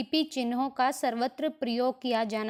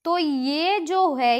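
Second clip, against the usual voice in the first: ordinary talk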